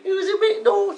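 very high put-on motherly voice; caller's mum. Dave's mum introducing herself on the phone, high put-on voice. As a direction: play a mum in a high, squeaky register. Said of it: "It's Dave's mum".

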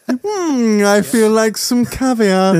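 teasing Marlon as a big spender; mock posh indulgence. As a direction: savour it like a spoiled rich man.

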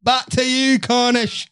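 hammed-up British accent catchphrase. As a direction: play a cheeky Brit tossing it back. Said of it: "in British accent".

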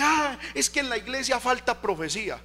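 lightly mock a dissatisfied church member; complaining churchgoer.

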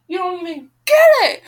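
high, loud exasperated yell; angry shout. Real, not acted. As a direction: shout it at the character. Reader rants at the heroine.

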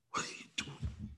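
breathless whisper to God; hushed despairing whisper; whispered plea. Retold or imitated. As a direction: barely voice the question.

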